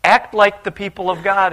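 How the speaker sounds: high, rising to loud disbelief; incredulous exclaim. Acted. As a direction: sound amazed at the foreigners. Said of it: "as you read the story, say".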